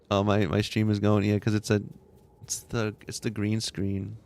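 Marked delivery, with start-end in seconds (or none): none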